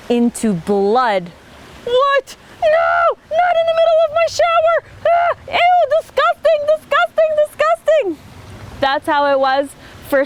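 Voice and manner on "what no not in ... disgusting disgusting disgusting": very high, loud squealing disgust; disgusted Pharaoh. Acted. Pharaoh finding blood in his shower, high and squealing. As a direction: shriek in comic disgust.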